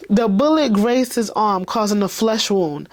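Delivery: usual speaking voice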